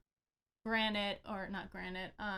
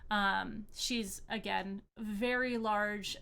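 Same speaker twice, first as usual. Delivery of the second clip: ordinary talk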